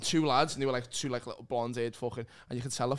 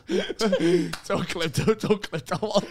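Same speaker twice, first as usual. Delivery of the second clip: laughing through the words, louder; laughing plea; 3 semitones higher, 5 dB louder, slower than usual. Real, not acted. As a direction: beg them not to clip it while cracking up. Laughing, begging not to clip.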